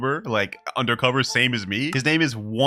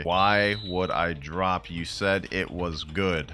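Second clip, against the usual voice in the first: very slow, lower-pitched dictation; typing aloud; 5 semitones lower, 4 dB quieter, much slower than usual. Acted. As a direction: drag out each word while typing. Dictates his reply while typing.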